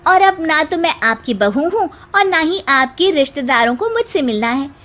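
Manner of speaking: pointed daughter-in-law retort; indignant young bride. Acted. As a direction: answer the gossips back with polite indignation. Bride defends mother-in-law to gossips.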